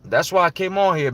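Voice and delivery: ordinary talk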